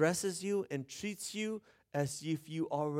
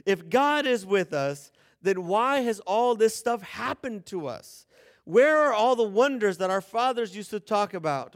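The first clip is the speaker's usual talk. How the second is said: bitter complaint; 3 semitones higher, 4 dB louder than usual. Retold or imitated. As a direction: throw the question back at heaven.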